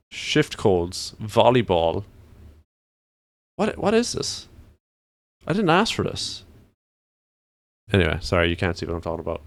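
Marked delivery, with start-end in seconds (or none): 3.6-6.4 high, incredulous protest; exasperated surprise. Real, not acted. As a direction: complain in disbelief. Indignant at trending searches.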